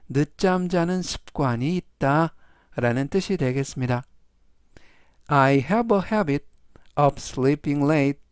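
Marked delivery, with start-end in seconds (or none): 5.3-8.2 very slow, each word separated; slow, clear reading. Shown as a demonstration. Teacher slowly reads the sentence three times for students. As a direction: read slowly so students can follow. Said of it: "제가 역시 천천히 세 번씩 읽어드리고요".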